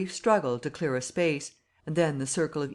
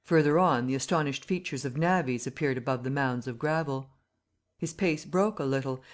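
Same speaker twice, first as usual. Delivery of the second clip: usual speaking voice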